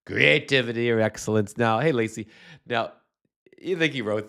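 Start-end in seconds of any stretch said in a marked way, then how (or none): 0.1-1.6 hammed-up Trump impression trailing off. Host mocking Trump's post to viewers, Trump voice. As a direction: finish the line as Trump. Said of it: "in my Trump voice".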